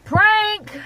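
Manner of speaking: high, loud call; sudden high-pitched shout; prank reveal shout. Real, not acted. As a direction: yell it out as a gotcha.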